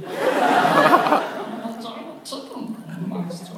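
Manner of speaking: high, very slow strained creature voice; chicken monster voice. Pretend. Voice actor as chicken monster introducing himself. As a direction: stretch it out like a giant chick. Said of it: "I'll be that character".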